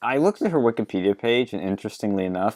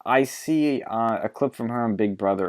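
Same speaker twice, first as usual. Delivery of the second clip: ordinary talk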